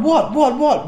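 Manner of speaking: high puzzled what, rising; mock bewildered colleague; a teacher who doesn't get the joke, puzzled; confused teacher. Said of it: "The teacher is going".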